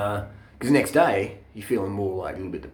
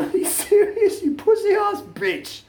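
much higher, laughing through a mocking jeer; laughing taunt; 4 dB louder, slower than usual. Laughing at his friend having a panic attack, a high laughing taunt. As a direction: cackle the insult out in a high squeal. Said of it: "I just pissed myself laughing".

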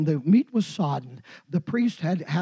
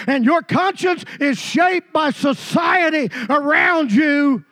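louder, higher preaching that builds to a shout; full-force preaching; 7 semitones higher, 5 dB louder than usual. Acted. Preacher warning his congregation, full-force preaching. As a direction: drive it home to the congregation.

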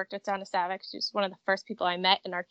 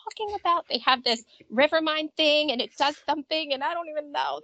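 panicked rant; 6 semitones higher than usual. Retold or imitated. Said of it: "I'm like".

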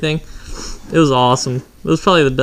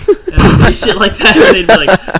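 laughing through the words; cracking up mid-sentence; 5 semitones higher, 5 dB louder than usual. Real, not acted. Laughing while telling.

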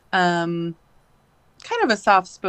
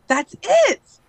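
sudden yell, much higher and louder; sudden shout; 13 semitones higher, 7 dB louder than usual. Retold or imitated. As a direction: snap out a loud burst. Teacher suddenly explodes, drops books.

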